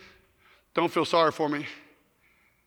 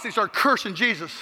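usual speaking voice

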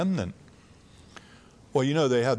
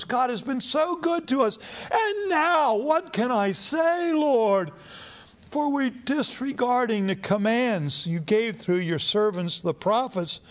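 much higher, straining prayer; desperate pleading; 10 semitones higher than usual. Acted. Ezra crying out to the Lord over disobedience, high desperate plea. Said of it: "he starts pleading with God".

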